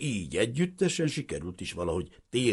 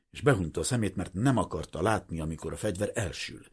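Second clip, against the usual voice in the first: usual speaking voice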